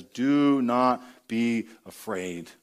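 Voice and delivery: slow, low, tender reassurance; God speaking comfort to the fearful, slow and tender; God's gentle command. Retold or imitated. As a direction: say it slowly, like God calming a child. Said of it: "the God of this book says".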